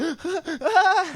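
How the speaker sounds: high hammed-up gasp; over-the-top weepy gasp; mock sobbing gasp. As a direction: gasp as if bursting into tears. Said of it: "I was totally like".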